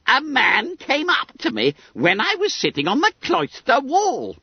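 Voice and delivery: high, squeaky schoolboy voice; self-satisfied Billy Bunter. A narrator's reading. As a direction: pipe it up high, full of importance.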